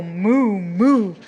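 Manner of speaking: drawn-out, loud mooing; cow moo. Shown as a demonstration. Teacher mooing for young learners to copy. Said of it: "He can go like a cow".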